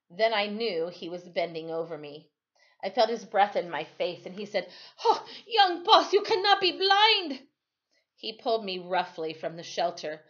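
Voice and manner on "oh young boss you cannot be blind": high, loud anguished cry; distraught plea. Acted. Timothy cries over the blinded boy, high and loud. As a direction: cry out refusing to believe it.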